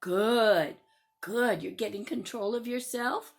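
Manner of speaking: very low, measured adult voice; approving teacher. Acted. Teacher praising a child calming down, approving.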